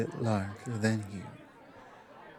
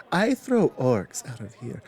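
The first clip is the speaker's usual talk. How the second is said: usual speaking voice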